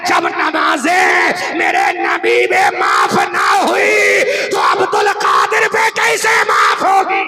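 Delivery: rapid shouted litany building to a roar; preaching at full force. Acted. Preacher roaring the litany to the climax. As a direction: pound each question harder.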